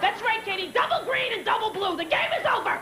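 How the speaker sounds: high, mock-triumphant crowing; hammed-up competitive gloat; gloating aunt. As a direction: act out crowing over a board-game win. Gloating at six-year-old over game.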